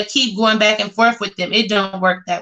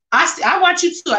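excited; 8 semitones higher, faster than usual. Real, not acted. Excited greeting to a viewer.

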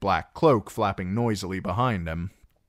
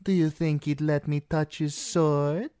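higher teasing woman's voice; flirty dancer; 9 semitones higher than usual. Acted. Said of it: "the other said".

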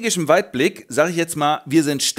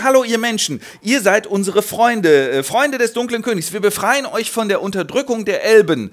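wheedling recruiter; 7 semitones higher, 3 dB louder than usual. Acted. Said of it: "Menschen hingegen".

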